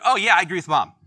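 a cheeky, loud "I agree with Mom"; sly loud agreement. Retold or imitated. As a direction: burst out loudly, all innocent agreement. Dad playfully echoing mom's answer.

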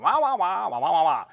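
loud muffled wah-wah trombone voice; Charlie Brown adult voice. Retold or imitated. Preacher imitating Charlie Brown adults for congregation, loud wah-wah. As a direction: mimic the cartoon grown-up on the phone. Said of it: "it was always like".

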